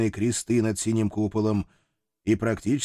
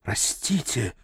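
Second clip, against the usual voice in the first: strained voice; 3 dB quieter, slower than usual. Acted. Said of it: "не своим голосом выдавил".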